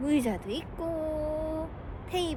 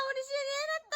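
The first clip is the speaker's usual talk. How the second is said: high praising; 10 semitones higher, much faster than usual. Real, not acted. Praising baby's achievement.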